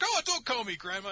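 high, loud mock whine; offended protest. Aunt objecting to being called grandma, mock-offended. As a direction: squawk it like an insulted aunt.

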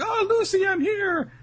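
very high, loud mock girlish call; hammed-up high holler. Rebecca calls from the window.

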